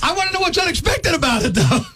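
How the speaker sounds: high-pitched comic indignation; exasperated rant. Acted. Ranting to co-host about the checkout, exasperated. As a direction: demand an answer, voice jumping up high.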